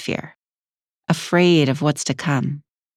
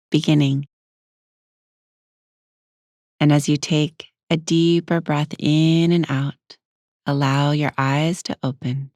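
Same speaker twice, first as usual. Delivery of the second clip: unhurried meditation guide with long pauses; guided meditation. Acted. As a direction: ease the listener inward, leaving space between phrases.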